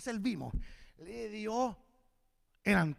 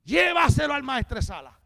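loud, higher commanding call; forceful command; 5 semitones higher, 12 dB louder than usual. Acted. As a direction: call out the order firmly. Acted command of Jesus, first take.

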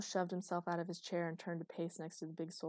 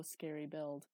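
ordinary delivery

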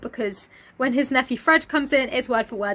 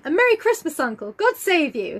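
high, bright festive greeting; cheerful cry; 6 semitones higher than usual. Acted. Nephew Fred greeting Scrooge, cheerful cry. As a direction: call it out cheerily, pitch leaping up. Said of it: "cried a cheerful voice".

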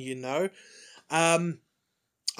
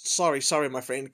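usual speaking voice

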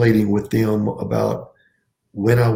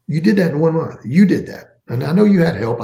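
higher and louder, proudly insisting; emphatic praise; 8 semitones higher, 5 dB louder than usual. Retold or imitated. Retelling praise to an exhausted teacher, emphatic and raised. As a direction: lift it up, really drive home "you did that".

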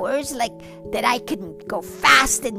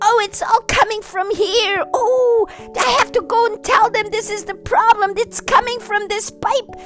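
excited discovery; 6 semitones higher than usual. Acted. Bunny discovers the leak.

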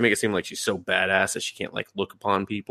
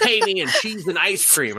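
lizard guy demand; 12 semitones higher than usual. Retold or imitated. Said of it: "the lizard guy's like".

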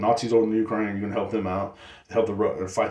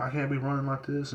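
ordinary talk, nothing marked

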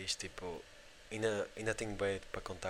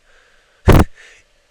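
burst of laughter; 30 dB louder than usual. Real, not acted. Laughing at the scene.

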